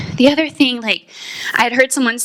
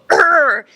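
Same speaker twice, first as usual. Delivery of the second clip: short loud mock "Urgh!", higher; imitated battle grunt; 5 semitones higher, 3 dB louder than usual. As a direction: grunt like a big man rallying for a fight. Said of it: "I always see him go".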